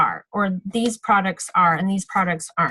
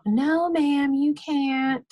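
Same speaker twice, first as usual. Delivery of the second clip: higher, lightly voiced schoolkid reply; imitated pupils' answer; 4 semitones higher, much slower than usual. Voicing the kids' chorused answer.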